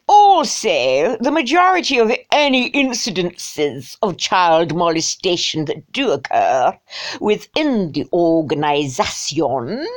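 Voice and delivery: hammed-up snotty upper-class English accent; mock upper-class English snob. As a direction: put on a snooty, drawn-out English toff accent. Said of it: "I think snotty English".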